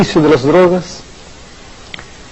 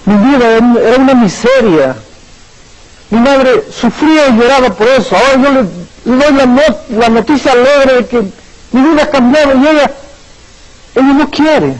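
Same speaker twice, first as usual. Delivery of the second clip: higher, pained pleading tone; sad, tearful letter; 8 semitones higher than usual. Retold or imitated. Daughter writes to the pastor of her mother's rejection, higher and sorrowful. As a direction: read it as a grieving daughter near tears. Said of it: "llorando, con mucha tristeza".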